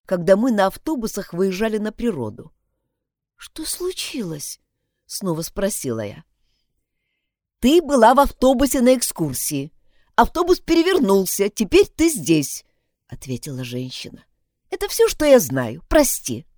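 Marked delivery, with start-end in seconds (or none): 3.4-4.6 slow breathless whisper, barely voiced; faint hospital-bed whisper; weak whisper. 7.6-16.5 a hint of a firm nurse, louder; brisk nurse telling the news, a bit louder; lightly voiced nurse.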